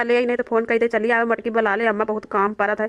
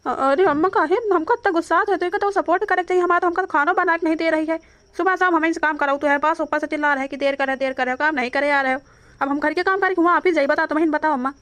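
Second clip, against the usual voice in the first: high-pitched pleading complaint; aggrieved, higher whine of complaint; exasperated protest; 5 semitones higher than usual. Acted. Son protests his mother's scolding.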